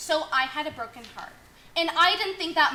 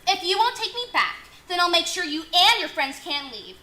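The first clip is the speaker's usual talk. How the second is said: angry; 4 semitones higher, 6 dB louder than usual. Acted. Scorned ex threatens.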